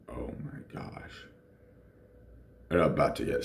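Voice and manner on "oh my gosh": horrified whispered gasp; shocked whisper. Retold or imitated. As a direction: breathe it out, stunned. Seeing her tooth break.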